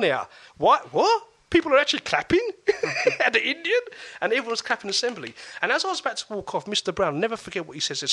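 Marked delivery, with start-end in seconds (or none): 0.6-3.8 mock disbelief. Boy amazed classmates clap for him, laughing disbelief. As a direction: pitch jumps high, laughing in disbelief.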